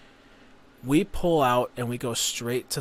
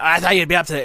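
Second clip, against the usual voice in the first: raised, higher mock scream; imitated screaming; 5 semitones higher, 9 dB louder, much faster than usual. Sister getting at a late sleeper, imitated screaming. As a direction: imitate a nagging sister yelling. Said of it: "just constantly screaming".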